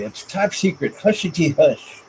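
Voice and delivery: playful whispery-secret Donkey quote, lightly voiced. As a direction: lightly imitate the chatty donkey. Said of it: "Like Donkey said in the".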